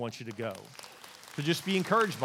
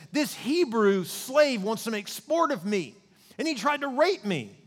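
raised, higher-pitched cry of accusation; mock yelling; 6 semitones higher, 4 dB louder, slower than usual. Wife accusing Joseph before the servants, higher, louder mock outcry. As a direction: act out a drawn-out, outraged yell, half-hearted. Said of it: "she just starts screaming and yelling".

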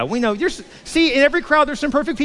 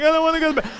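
put-on protesting child; 6 semitones higher, much faster than usual. Child protesting bedtime to his sister.

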